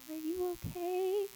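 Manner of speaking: gentle, soft "are you okay"; gentle check-in. Retold or imitated. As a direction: ask it tenderly, like to a hurt friend. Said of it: "that might be a little gentle".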